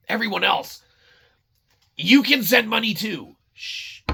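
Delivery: ordinary delivery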